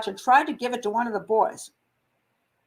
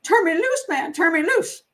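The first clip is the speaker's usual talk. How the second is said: high panicked yell; frightened scream; 6 semitones higher, 3 dB louder than usual. Retold or imitated. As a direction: scream to get free.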